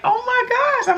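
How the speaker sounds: high-pitched stunned oh my gosh; shocked gasp. Retold or imitated. Shocked reaction quoted in birth story.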